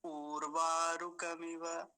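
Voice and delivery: उर्वारुकमिव slowly with a long ऊ; slow wrong pronunciation with a long vowel. Shown as a demonstration. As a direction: show the mistake of a drawn-out उ. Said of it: "कैसे बोलते हैं".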